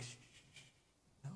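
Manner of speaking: urgent whispered hush; shushing whisper. Retold or imitated. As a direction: hush her in a panicked whisper. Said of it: "and I'm like".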